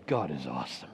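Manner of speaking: low, soft awed murmur; hushed praise. Pretend. Preacher voicing a believer's quiet praise, hushed. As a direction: say it softly, in awe. Quoting worshipper's heartfelt praise.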